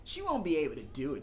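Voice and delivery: ordinary talk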